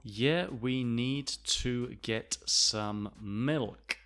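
each word separate, very slow; slow model sentence for learners; measured word-by-word reading; slow and careful. Shown as a demonstration. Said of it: "when we're speaking naturally".

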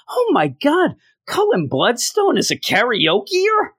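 high-pitched, incredulous gasp of words; mock astonishment. Reviewer mocking a silly comic reveal, mock astonishment. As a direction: feign scandalized shock.

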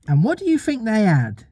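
lightly voiced little mother beginning her tale; Wendy telling a story.

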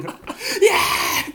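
high, slow drawn-out whoop; excited cheer. Retold or imitated. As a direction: cheer like a rowdy kid, stretched out. Boys cheering over tennis balls.

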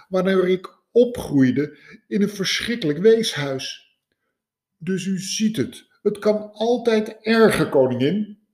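high, lively scientist voice telling his woes; a cheerful man listing misfortunes; lightly voiced consoling scientist; eager scientist. Scientist voices his sad story.

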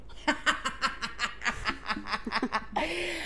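quiet breathy laugh; soft giggle. Real, not acted. Laughing at her teen crush on [name]. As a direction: giggle at the memory.

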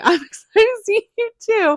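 high, laughing excited reply. Retold or imitated. Answering Kalani's text, high and laughing. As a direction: giggle through it. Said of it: "And I was like".

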